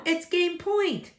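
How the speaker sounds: high, slow emphatic protest; son's protest. A narrator's reading. Son protesting to mom at game point, high and drawn out. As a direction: stretch it out in protest.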